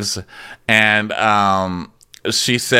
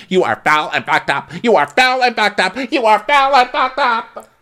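building from raised to very high pitch; mock tirade; 12 semitones higher than usual. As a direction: repeat the insult higher each time, dragging the last. Acted insult, repeated and building.

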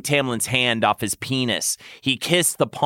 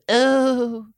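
moaning; 11 semitones higher than usual. Acted. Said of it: "Tamlin moaned".

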